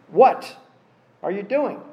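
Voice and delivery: "What" high and loud, then a pause; stress on What. Shown as a demonstration. God questioning the self-pitying prophet, "What" stressed. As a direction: land hard on "What". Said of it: "How did God ask this question?".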